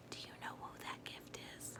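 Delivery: soft secretive whisper. Acted. Asking young listeners a secret question, whispered.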